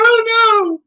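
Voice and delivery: high, loud mock wail; mock crying. Showing how one breaks down crying, high mock wail. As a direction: wail it out, hammed-up.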